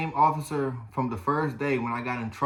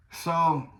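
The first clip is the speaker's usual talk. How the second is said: ordinary delivery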